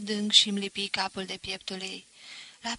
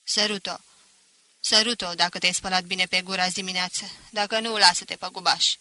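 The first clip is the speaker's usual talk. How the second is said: loud, barked orders from the grandmother; stern command; 7 dB louder than usual. A narrator's reading. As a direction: command him like a fierce old matriarch. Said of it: "îmi porunci".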